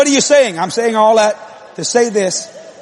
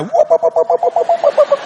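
rapid mock syllable rattle; imitated whooping. Husband's complaint about wife in bed, high whooping imitation. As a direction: babble climbing into high repeats. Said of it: "I don't know what I got over there".

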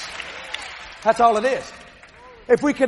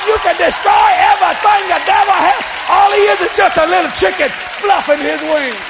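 high, loud revival preaching; full-force preaching; 6 semitones higher, 5 dB louder than usual. Real, not acted. Preacher declaring the devil's head bruised, full-force preaching. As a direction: drive it home to the congregation.

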